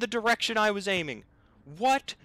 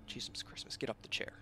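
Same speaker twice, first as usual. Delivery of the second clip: whispered exasperation; 6 semitones lower, 13 dB quieter, much faster than usual. Real, not acted. Panicked muttering at the game.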